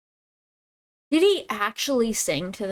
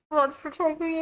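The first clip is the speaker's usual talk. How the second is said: high-pitched plea for help; mock pleading; 6 semitones higher than usual. Streamer begging mods for protection, high mock plea. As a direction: beg in a raised, put-on voice. Repeated plea, now overwrought.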